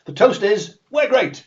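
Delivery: hearty toast. Acted. Nobles raise a toast.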